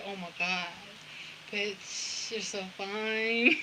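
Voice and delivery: quietly smitten, voicing an admirer; hushed swoon.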